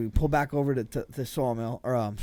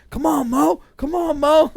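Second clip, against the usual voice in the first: much higher, louder cheering chant; crowd cheering; 14 semitones higher, 10 dB louder, slower than usual. Retold or imitated. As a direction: egg him on, rising. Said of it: "They're calling him".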